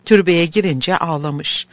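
ordinary delivery, nothing marked

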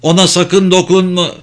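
high, urgent cry of warning. A narrator's reading. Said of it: "Jean güverteyi yumruklayarak haykırdı".

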